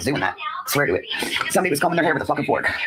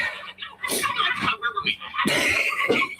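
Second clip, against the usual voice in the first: high squealing laugh; 5 dB quieter than usual. Real, not acted. Laughing at the dream.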